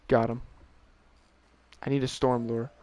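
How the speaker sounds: normal talking voice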